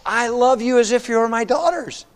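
high, heartfelt preacher's plea; impassioned affection. Acted. Naomi tells her daughters-in-law she loves them, impassioned. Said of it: "She's saying this".

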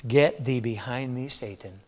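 low, hushed rebuke fading to almost nothing; quiet, grave words to Peter; stern rebuke. Acted. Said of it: "he turned and said unto Peter".

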